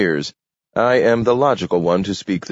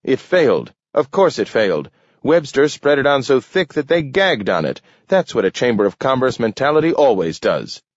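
higher, worked-up objector; scornful alderman; 7 semitones higher, faster than usual. A narrator's reading. Alderman mocking the Home Week scheme, scornful. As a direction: argue back with scorn.

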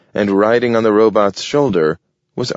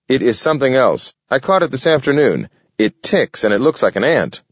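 higher, quick indignant insistence; indignant anger; 6 semitones higher, faster than usual. A narrator's reading. Raccoon insisting to Homer, hotly indignant. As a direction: insist hotly, rushing the words. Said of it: "puffing up in anger".

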